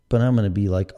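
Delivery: ordinary talk, nothing marked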